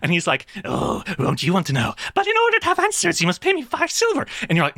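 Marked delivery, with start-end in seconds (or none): 0.6-4.3 high, mocking goblin; teasing goblin voice. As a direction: tease him like a greedy little goblin. Goblin demands payment, teasing.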